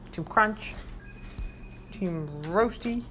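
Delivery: slow, low announcement of the team; low slow team name. Real, not acted. Owner handing out carrots, calling the team low and slow. Naming treat teams for the dogs.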